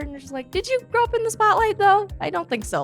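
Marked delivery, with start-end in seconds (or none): 0.5-2.1 mock incredulous. Quoting skeptical contestant.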